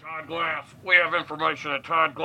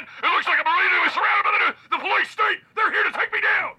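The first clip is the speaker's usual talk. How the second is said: high panicked yelling; Alex Jones impression; 13 semitones higher than usual. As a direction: shout like you're being surrounded. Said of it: "It's Alex Jones".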